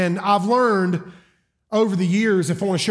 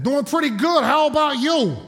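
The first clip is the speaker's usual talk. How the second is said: put-on light New Jersey or Brooklyn accent, pitched up; New York-area accent; 6 semitones higher than usual. Seatmate answering the preacher's greeting, New York-area accent. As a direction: put on a Bronx accent, higher. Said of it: "not gonna try to do the accent".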